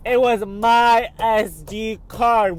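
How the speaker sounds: drawn-out joyful disbelief; slow overjoyed. Real, not acted. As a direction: stretch each word out in happy relief. Finds lost SD card.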